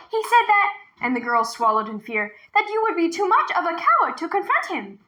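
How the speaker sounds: put-on high, urgent little girl's voice; a frightened girl luring the tiger; young girl. A narrator's reading. Girl leading the tiger to the well, high and urgent.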